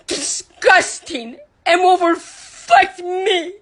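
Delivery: slow, high and tearful; real grief breaking through. Real, not acted. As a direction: let the voice crack with hurt. Breaking down over foundation page.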